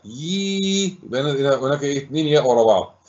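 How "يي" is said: يي as a long held, higher-pitched "yii"; lengthened ya. Shown as a demonstration. Teacher showing the long ya, يي held out high. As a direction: stretch يي out on one high note. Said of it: "الأخرى ممدودة بالياء".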